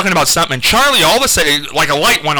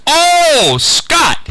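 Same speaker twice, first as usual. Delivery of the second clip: imitating Charlie; 14 semitones higher, 4 dB louder than usual. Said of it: "Charlie goes".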